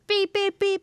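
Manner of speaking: car horn beeps. Retold or imitated. Imitating car horns.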